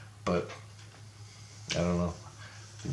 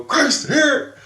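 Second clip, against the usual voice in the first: high, loud mock shout; retold urgent call; 5 dB louder than usual. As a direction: call out as if handing something over in a rush.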